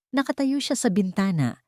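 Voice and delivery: plain reading voice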